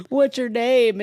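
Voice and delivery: high-pitched, weepy mock question; mock tearful asking. Crash victim asking the paramedic's name, mock tearful. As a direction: imitate asking through tears. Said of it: "uncontrollably sobbing".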